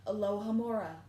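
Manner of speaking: plain reading voice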